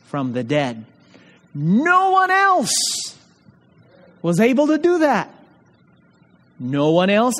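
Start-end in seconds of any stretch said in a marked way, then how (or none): 1.5-5.3 emphatic preaching cry. Real, not acted. Preacher to his congregation on the resurrection, soaring emphatic cry.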